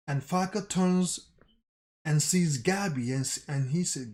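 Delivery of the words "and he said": ordinary talk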